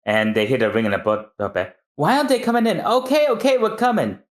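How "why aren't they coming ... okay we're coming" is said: high, impatient sisters; sisters at the door. Acted. Sisters answer the ringing doorbell, high and impatient. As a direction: call out toward the door, much higher.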